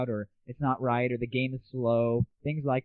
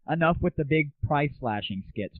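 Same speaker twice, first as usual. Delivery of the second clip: ordinary delivery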